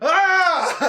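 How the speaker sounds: loud high yell of pain; full-throated "ah!"; cramp scream. Retold or imitated. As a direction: reenact a sudden cramp with a big scream. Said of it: "I was like".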